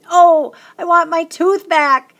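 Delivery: high, loud, slow cry. A narrator's reading. Child throwing stones and tooth, crying out loudly. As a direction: wail it out to the sky. Child cries out before running.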